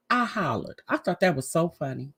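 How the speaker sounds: ordinary talk, nothing marked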